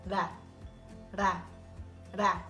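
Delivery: "ra" with a single light tongue tap; soft r demo. Shown as a demonstration. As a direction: tap "ra" once, tongue mid-palate. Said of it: "Right in the middle".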